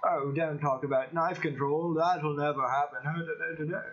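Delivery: a light mock posh British accent, dismissive; lightly put-on posh British accent waving off a point; Piers Morgan impression. Vlogger mocking Piers Morgan's posh British dismissiveness, light posh British accent. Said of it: "They talk like that".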